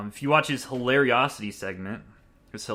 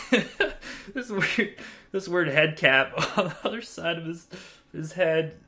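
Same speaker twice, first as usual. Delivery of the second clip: laughing; 7 semitones higher, 4 dB louder, slower than usual. Real, not acted. Laughing while describing costume.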